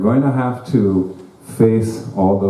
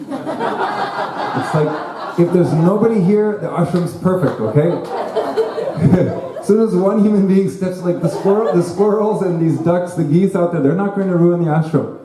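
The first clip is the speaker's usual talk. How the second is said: much higher, laughing through the joke; 8 semitones higher than usual. Real, not acted. Teacher joking to devotees that people ruin the ashram, laughing. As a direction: giggle it out.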